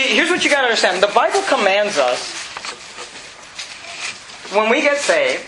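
ordinary talk, nothing marked

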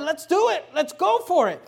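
high, loud rallying cry; eager shout. Acted. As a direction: fire up your leader. Armor bearer urging Jonathan.

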